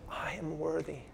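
hushed, inviting the listeners to come; a soft, earnest call to give everything; solemn Jesus invitation. Retold or imitated. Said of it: "Jesus here this morning is saying".

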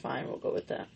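breathy unvoiced concession; whispering. Real, not acted. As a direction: whisper it, giving in. Conceding quietly.

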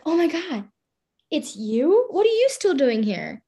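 mock shock at waking up beside her; shocked friend. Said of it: "she was so shocked".

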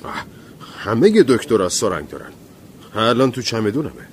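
hurried, flustered self-defense; mildly panicked excuse. Acted. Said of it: "دکتر دستپاچه گفت".

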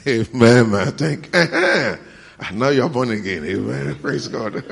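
loud, high, laughing praise; exuberant, laughing shout to the congregation; laughing exclamation. Real, not acted. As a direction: burst out through real laughter.

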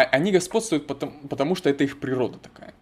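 usual speaking voice

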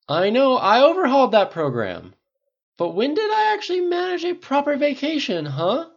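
high-pitched loud complaint; exasperated rant. A narrator's reading. Pepper complaining she never takes vacations, exasperated shout. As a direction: throw it back loudly, fed up.

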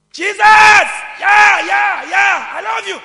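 high, full-volume drawn-out shouts; mock worship shouting. Preacher imitates public worshippers shouting to Jesus, loud shouting. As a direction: shout praise like a showy worshipper. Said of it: "You just have shouting".

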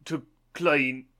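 halting British accent, word by word; tentative, broken-English British attempt. Shown as a demonstration. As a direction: try broken English in British, rising loudly at the end. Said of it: "do some broken English in a British accent".

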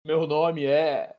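deliberate airline captain announcement; pilot over the radio. Retold or imitated. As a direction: play the captain greeting passengers. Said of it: "comandante de avião".

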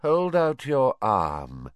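slow, languid command; lazy. Acted. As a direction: drawl it out carelessly. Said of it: "said Voldemort lazily".

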